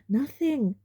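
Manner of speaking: ordinary talk, nothing marked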